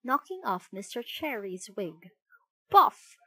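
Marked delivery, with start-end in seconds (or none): 2.7-3.2 high loud cartoon hit noise; loud playful sound effect; comic biff. A narrator's reading. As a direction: punch it out like a comic strip. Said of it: "went Mister Cherry".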